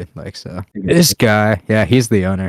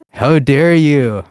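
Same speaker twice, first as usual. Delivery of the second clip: mocking outrage; 4 semitones higher, 6 dB louder, much slower than usual. Mocking quote of Greta.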